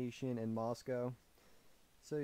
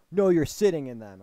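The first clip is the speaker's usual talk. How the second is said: imitating angry man; 3 semitones higher, 14 dB louder than usual. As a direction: cut in loudly, playing the angry man. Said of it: "this dude was mad".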